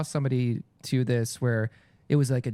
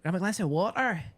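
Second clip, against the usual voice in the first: put-on Glaswegian accent, a little higher; 4 semitones higher than usual. Shown as a demonstration. Actor showing what Glaswegian sounds like, put-on Glaswegian accent. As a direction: imitate broad Glasgow speech. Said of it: "Glaswegian is very".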